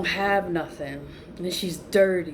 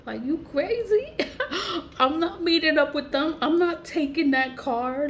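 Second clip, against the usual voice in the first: high-pitched, half-laughing retold refusal; incredulous refusal; 8 semitones higher than usual. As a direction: act out refusing, laughing in disbelief. Acted refusal, laughing in disbelief.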